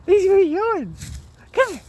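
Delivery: high sing-song call to a dog. Real, not acted. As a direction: coo after the dog.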